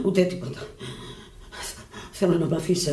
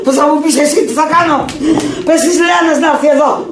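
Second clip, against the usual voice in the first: much higher and louder, raging; furious shouting; 11 semitones higher, 5 dB louder than usual. Acted. Sick woman yelling at her friend in fury. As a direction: scream at her to shut up.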